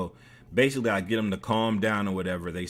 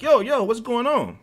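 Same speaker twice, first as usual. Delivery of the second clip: high, loud urgent questioning; retold urgent interruption; alarmed intervening; 12 semitones higher, 5 dB louder than usual. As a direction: break up a fight with raised voice. Breaking up the fight.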